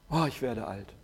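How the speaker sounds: mock sighing self-pity. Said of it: "Wie oft sagen wir Sachen über uns".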